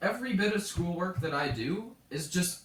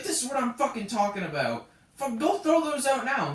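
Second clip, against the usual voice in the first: raised-pitch mock outburst, building louder; imitated angry cussing; 5 semitones higher, 5 dB louder than usual. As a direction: mimic an exasperated librarian giving orders. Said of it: "cussing up a storm".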